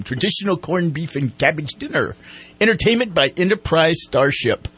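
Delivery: usual speaking voice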